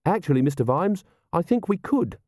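high, eager character voice; helpful correction, pitched well up; keen subordinate piping up; earnest young officer. Acted. Carrot helpfully correcting his commander.

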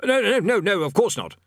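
high, loud hasty denial; hurried. A narrator's reading. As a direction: rush the denials. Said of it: "said the bursar hurriedly".

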